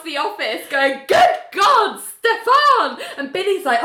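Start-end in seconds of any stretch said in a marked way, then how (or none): 1.1-3.0 high, loud panicked cry; hammed-up horrified calling out; mock alarm. John runs over calling to frog.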